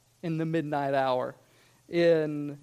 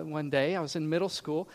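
normal talking voice

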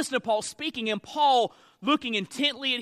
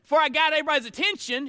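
ordinary delivery

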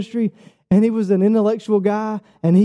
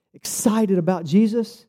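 ordinary delivery

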